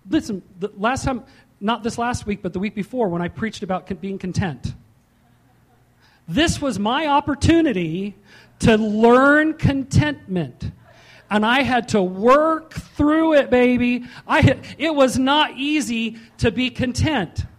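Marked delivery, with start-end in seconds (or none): 6.3-17.4 loud, slow, drawn-out sermon build; full-force preaching. Real, not acted. Preacher driving home contentment to congregation, full force. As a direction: hammer home the lesson on contentment.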